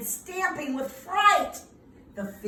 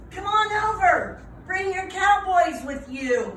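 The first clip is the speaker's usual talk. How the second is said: high, louder call from afar; 7 semitones higher, 5 dB louder than usual. Acted. Aunt Fern calling the cowboys to her barbecue, loud and high. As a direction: call them over. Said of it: "Aunt Fern called".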